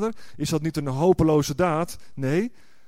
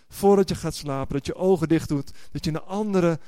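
ordinary talk, nothing marked

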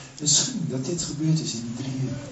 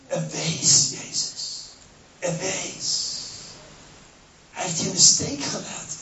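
Satan taunting; 5 semitones higher, slower than usual. Acted. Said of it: "En Satan keek hem recht in de ogen".

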